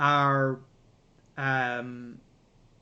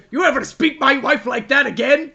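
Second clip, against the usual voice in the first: loud, high mock threat; angry husband; 8 semitones higher, 10 dB louder than usual. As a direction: play an outraged husband starting a bar fight. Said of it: "smacks him in the face and goes".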